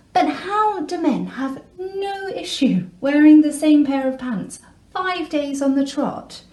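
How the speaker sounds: high-pitched exasperated question; indignant and baffled, voice lifted; incredulous indignation. Acted. Incredulous complaint about partner's hygiene.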